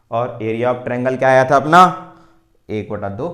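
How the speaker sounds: usual speaking voice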